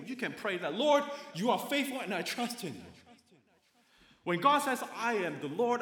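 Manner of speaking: loud, high-pitched preacher's delivery; emphatic preaching. Real, not acted. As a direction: drive the promise home to the congregation, loud and high.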